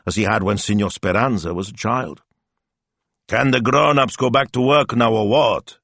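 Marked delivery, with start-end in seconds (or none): 3.3-5.8 higher-pitched impatient demand. A narrator's reading. Old Zio asks when work can restart, impatient. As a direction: ask it with mounting impatience. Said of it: "he asked, impatient".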